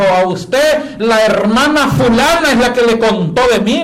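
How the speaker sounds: loud, high and slow, every word hammered in rage; furious yelling. Retold or imitated. Angry woman accuses the preacher of gossip, slow and loud. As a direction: spit it out slowly in a rage. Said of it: "una señora bien furiosa".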